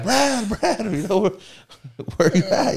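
high, anxious calling of a name. Retold or imitated. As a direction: call out for a missing friend. Calling out for missing friend.

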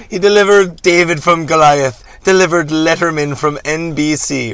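hammy pulpit oratory; preacher reverend, defiant. Acted. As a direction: preach defiantly at full swing.

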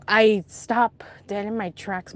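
usual speaking voice

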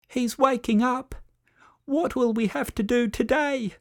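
high hushed whisper, nervous; murmured meerkats. A narrator's reading. Narrator voicing meerkats murmuring about waking King, high whisper. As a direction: whisper it like a worried little meerkat. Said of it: "murmured the meerkats".

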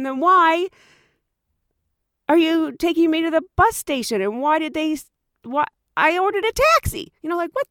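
mock exasperated outburst. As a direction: demand answers in disbelief. Her irritated retort.

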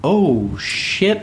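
drawn-out, stunned reaction, rising high; stunned dismay. Retold or imitated. Driver reacting to the lady's remark, stunned dismay. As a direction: re-enact being taken aback. Retelling shocked reaction in car.

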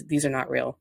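ordinary delivery

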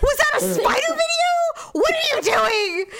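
high-pitched indignant questioning; hammed-up imitation of a furious demand; mock outraged. Woman retells Daryl demanding about the video, mock outraged. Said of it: "Like, he was outraged".

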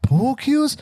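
POOL CUES as a high rising question; hammed-up puzzled "Pool cues?"; incredulous echo. Acting a puzzled listener's question.

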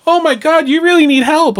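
high, loud hammed-up coworker gasp; fake concern. Department heads watching her drown, high loud mock concern. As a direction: overplay a coworker's shocked concern. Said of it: "other department heads like".

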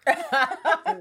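loud, high laughing burst; high burst of laughter. Real, not acted. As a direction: crack up loudly. Grandma's crude saying cracks them up.

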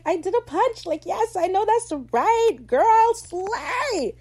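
high, hyped-up squeal building; excited cheering. Retold or imitated. Fan cheers on Steed's punch, excited high cheer. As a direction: cheer a friend on at a high pitch.

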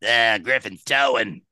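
slow smoker dispatcher impression; heavy smoker's voice. Tow company dispatcher answering the phone, slow smoker's voice. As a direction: imitate a chain-smoking woman, slowly. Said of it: "sounds like she smoked about five packs".

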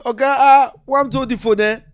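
put-on high, loud Nigerian accent; Nigerian officer. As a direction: shout it like the cop. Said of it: "He said".

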